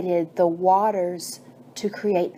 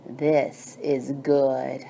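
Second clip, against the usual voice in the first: God's voice; 6 semitones lower, 3 dB quieter, much slower than usual. Acted. God approves plants and fruits.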